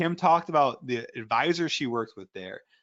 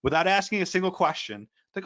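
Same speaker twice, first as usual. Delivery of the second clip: normal talking voice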